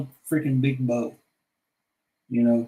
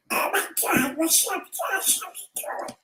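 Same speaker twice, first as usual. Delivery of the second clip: whispered voice; 13 semitones higher, 4 dB quieter than usual. Acted. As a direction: breathe the greeting. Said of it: "What's another voice I can do".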